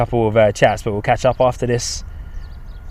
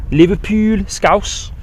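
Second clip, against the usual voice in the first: light Scouse imitation, lifting higher at the end; Scouse accent; 7 semitones higher, much slower than usual. Streamer touring UK accents for viewers, light mock Scouse. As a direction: put on a touch of Liverpool Scouse. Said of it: "different accents in the U K ... Scouse".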